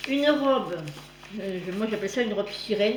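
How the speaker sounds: ordinary delivery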